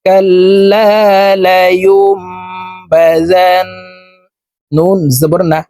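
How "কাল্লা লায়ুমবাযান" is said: Quran recitation. Shown as a demonstration. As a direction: chant it slowly with tajweed. (ধীর সুরেলা কুরআন তিলাওয়াত)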